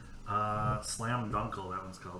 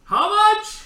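high-pitched, blaring shout of HEADPHONES; unwitting yell; 16 dB louder than usual. Retold or imitated. Noise-canceling passenger to stewardess, unwitting yell. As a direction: yell it over noise only you hear. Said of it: "I didn't realize I was yelling".

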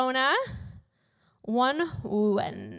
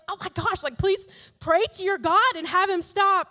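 higher-pitched, hammed-up begging; mock panicked pleading; 6 semitones higher, 3 dB louder than usual. Sailors begging Jonah to stop the storm, mock panic. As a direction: plead urgently, playing it for laughs.

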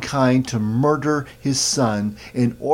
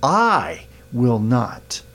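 long, swooping I, then a pause before "will not"; emphasis on I; much slower than usual. Shown as a demonstration. Preacher showing Peter's insistence, heavy emphasis on I. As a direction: stretch I very slowly and set it apart. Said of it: "with emphasis on the word I".

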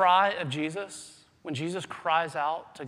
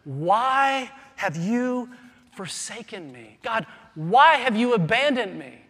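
loud, slow, pleading cry of abandonment; anguished plea; 3 semitones higher, 7 dB louder than usual. Retold or imitated. Jesus crying to God on the cross, anguished pleading. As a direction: plead as one forsaken. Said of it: "Jesus says".

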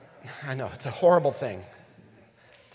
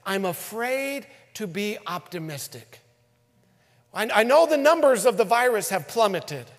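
quoting fearful people; 9 semitones higher, 8 dB louder than usual. Retold or imitated. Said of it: "people were saying things like this".

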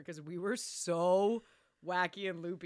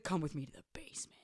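quick, low hushed summons; mock ominous whisper; 4 semitones lower, 6 dB quieter, faster than usual. Retelling him summoning her to the basement, whispered. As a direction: whisper it like a secret order.

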